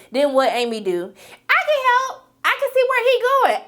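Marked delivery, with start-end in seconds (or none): 1.5-3.6 Amy's voice. Retold or imitated. Amy voiced in recap.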